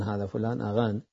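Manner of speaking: ordinary delivery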